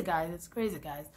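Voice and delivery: usual speaking voice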